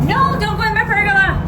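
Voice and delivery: pleading high-pitched with the lizard; real alarm, voice up high; high frightened plea. Real, not acted. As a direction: beg it not to go in. Lizard runs into the pergola.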